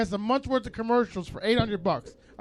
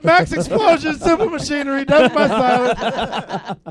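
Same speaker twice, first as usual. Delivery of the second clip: hype announcer; 5 semitones higher, 7 dB louder, slower than usual. Acted. Announcing band names hammed up.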